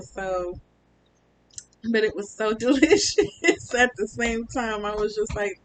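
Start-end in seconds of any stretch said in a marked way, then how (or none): 1.9-5.6 laughing through the words, louder and higher. Real, not acted. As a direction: giggle while you admit it. Laughing about the delicious pain.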